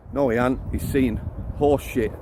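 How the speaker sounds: ordinary delivery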